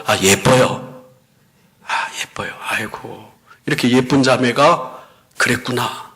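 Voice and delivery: low, soft murmur, partly whispered; retold gentle admiration, dropping to a near whisper; hushed, compassionate words to someone just freed; tender reassurance. Pastor gently comforts the freed woman.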